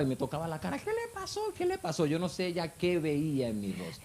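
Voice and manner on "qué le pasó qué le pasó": very high-pitched frantic questioning; imitated shocked cry. Wife hugging husband, asking what happened, high shocked cry. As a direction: mimic a wife's alarmed cry without full volume. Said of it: "gritando, en shock, preguntándome que qué me pasaba".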